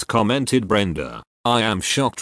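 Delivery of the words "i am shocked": ordinary delivery, nothing marked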